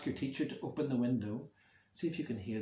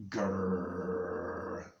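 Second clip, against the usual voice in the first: long low growl; growling dog; 5 semitones lower than usual. Acted. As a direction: imitate a dog holding back a bark. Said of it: "he just stares at them and goes".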